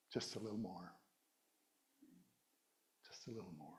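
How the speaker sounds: quiet, low, wry understatement. Retold or imitated. Rich movie character answering how much he needs, wry and quiet. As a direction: drop it soft and low, wryly. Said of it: "he had this wry look on his face".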